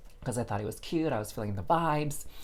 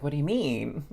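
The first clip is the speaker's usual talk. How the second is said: mild puzzled question, slightly higher; curious; 4 semitones higher, 4 dB louder than usual. Retold or imitated. Retelling her first response to the guy, curious. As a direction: ask it with restrained curiosity. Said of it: "freaking curious".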